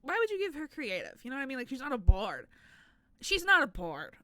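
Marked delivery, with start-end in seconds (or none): 0.0-1.2 mock skeptic. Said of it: "which you might be like".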